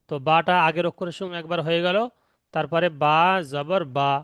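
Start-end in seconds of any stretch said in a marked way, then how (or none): none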